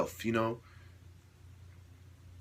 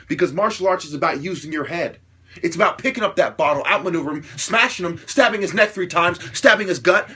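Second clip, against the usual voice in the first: ordinary delivery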